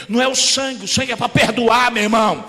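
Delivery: rising shout at the brother; scolding shout. Retold or imitated. Preacher yelling at a brother to cast out the demon. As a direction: yell the correction and build to the name of Jesus.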